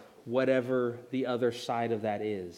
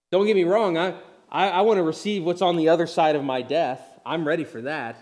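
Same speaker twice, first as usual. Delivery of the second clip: loud, raised-pitch insistence; emphatic clarification; 4 semitones higher, 7 dB louder, faster than usual. Real, not acted. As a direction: jump in forcefully to set it straight. Hastens to correct a possible misunderstanding.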